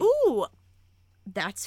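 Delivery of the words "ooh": high, loud "ooh"; real approving squeal; impressed ooh. Real, not acted. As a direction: let out a high whoop of an ooh. Reacting to a hot take.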